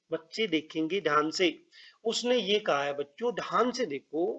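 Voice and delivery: ordinary talk, nothing marked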